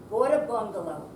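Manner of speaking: ordinary delivery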